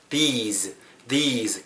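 "these" with a drawn-out ee and a z at the end; long vowel, z ending. Shown as a demonstration. Teacher showing the plural "these", long vowel with voiced ending. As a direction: stretch the vowel in "these" and finish on z. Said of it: "길게 발음하고 뒤에 z로 끝나야".

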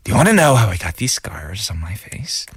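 practice take of a menacing movie villain; Joker. Acted. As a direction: put on a sinister comic-book villain voice. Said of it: "I'm practicing the Joker voice".

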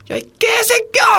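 very high, drawn-out cursing yell; imitated angry shouting. Director cursing out a first-time actor, imitated angry shouting. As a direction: mimic a director screaming abuse, high and slow. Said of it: "소리 지르고".

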